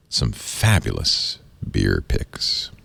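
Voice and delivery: mock announcer tease. Host teases segment after break.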